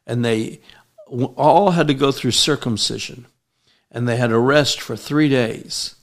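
usual speaking voice

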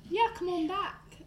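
effortful, playful British accent. Shown as a demonstration. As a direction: try a beckoning British accent. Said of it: "say that in a British accent".

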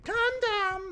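sudden yelled Condom; a plant yelling out Condom on cue; mock planted yell. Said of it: "you fucking scream".

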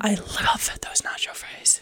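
whispered. Real, not acted. Dreamy aside about favorite food.

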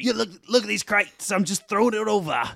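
high, hammed-up Cockney rant; wandering cartoon Cockney, pitched high; put-on Cockney accent. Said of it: "like, a Cockney accent".

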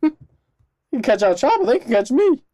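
high, loud laughing taunt; defiant boast. Pretend. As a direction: dare them to catch you.

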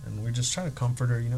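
ordinary talk

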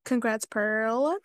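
usual speaking voice

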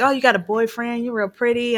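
lightly voiced creep chatting up the nurse; sleazy flirting. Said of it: "he's like".

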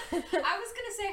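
high, laughing lead-in. Real, not acted. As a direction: laugh through the words. Laughs while speaking.

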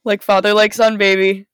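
loud gleeful punchline; gleeful shout. Real, not acted. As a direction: belt it out gleefully.

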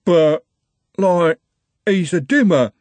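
loud, high, halting oafish voice; stammering excuse. A narrator's reading. Luckless big boy facing Trev's rage, struggling to explain. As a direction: force it out word by word.

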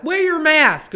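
high, loud preachy mask scold; hammed-up pro-mask scolding; mock mask scold. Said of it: "people who are like".

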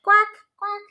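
high, loud quacking duck; loud playful duck call. Acted. Mom imitating duck for child.

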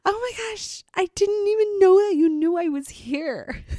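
put-on high, excited little-girl voice; imitating daughter. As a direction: squeak it out like a giddy six-year-old. Mom voicing daughter's excited quote.

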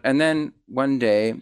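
usual speaking voice

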